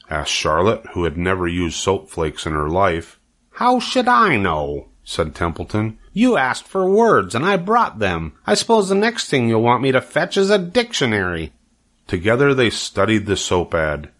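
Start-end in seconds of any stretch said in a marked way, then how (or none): 3.5-11.5 higher, huffy rat voice, fed up with errands; the rat protests he already did his part; indignant rat. Acted. Templeton snaps back indignantly.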